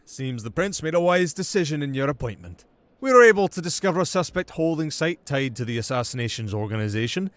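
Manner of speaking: a commanding prince announcing a decision; prince's voice. Acted.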